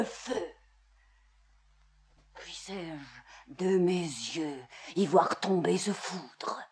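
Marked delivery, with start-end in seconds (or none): 2.3-6.6 low, very soft tragic declamation; hushed curse. Acted. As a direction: let the curse sink almost to a whisper. Curse in tragedy drops low.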